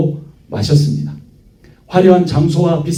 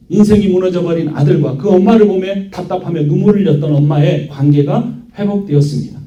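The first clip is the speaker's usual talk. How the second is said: ordinary talk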